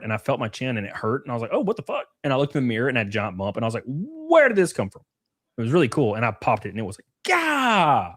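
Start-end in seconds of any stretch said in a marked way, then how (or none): 1.5-2.1 surprised. Retold or imitated. Retold reaction to pimple. 3.9-5.0 surprised. Retold or imitated. Retold reaction to pimple. 7.3-8.1 startled gah. Retold or imitated. Retold popping the pimple.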